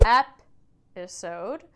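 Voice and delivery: episode split slowly, EP loud, then i-sode; primary stress shown. Shown as a demonstration. Teacher marking primary stress, episode slow with EP punched. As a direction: break episode after a punched EP. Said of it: "you have your first primary stress".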